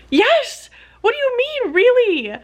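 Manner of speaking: high, loud exasperated comeback; incredulous retort. Retold or imitated. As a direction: re-enact disbelief, pitch leaping up. Retells her incredulous retort to committee.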